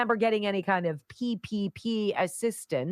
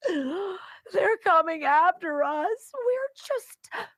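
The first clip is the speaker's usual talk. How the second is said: high, slow, pleading mock whimper; sarcastic impersonation of a self-pitying group; mocking whiny victim voice; 8 semitones higher, slower than usual. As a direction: parody a pitiful plea, drawn out and trembling into a whisper. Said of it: "the Somalians are not very happy".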